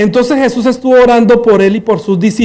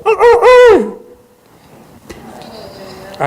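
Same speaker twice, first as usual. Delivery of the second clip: 1 anguished wail; 11 semitones higher, slower than usual. Acted. Peter remembers and weeps.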